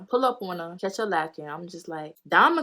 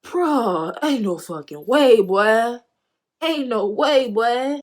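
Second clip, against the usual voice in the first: raised, higher-pitched incredulous outburst; loud disbelief; 4 semitones higher, 8 dB louder, much slower than usual. Retold or imitated. Storyteller replaying her reaction to a friend's denial, loudly incredulous. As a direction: call out a bald denial, loud and high.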